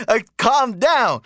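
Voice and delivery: high, drawn-out amused exasperation; exasperated. Retold or imitated. Replying to an angry man defending his queen, amused exasperation. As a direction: stretch it out to settle someone ranting.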